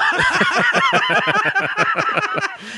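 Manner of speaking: long high-pitched burst of laughter; real high cackling laugh. Real, not acted. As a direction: laugh hard at the joke. Reaction to the teasing joke.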